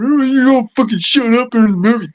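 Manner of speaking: high, loud whiny mimicry of the moviegoers; mocking the complainers. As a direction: parody their nagging in a high put-on voice. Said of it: "sitting there going".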